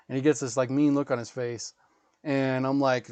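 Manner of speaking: ordinary talk, nothing marked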